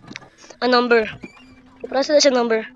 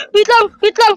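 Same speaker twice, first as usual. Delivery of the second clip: ordinary delivery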